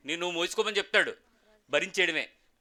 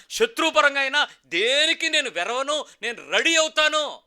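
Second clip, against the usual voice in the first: a believer's prayer growing into a shouted promise to God; fervent prayer; 5 semitones higher, 4 dB louder than usual. Acted. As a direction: pray it out, building to a roaring vow. Leads a model prayer.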